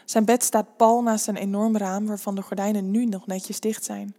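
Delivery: plain reading voice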